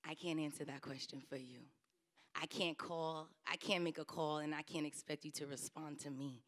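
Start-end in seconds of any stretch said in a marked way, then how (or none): none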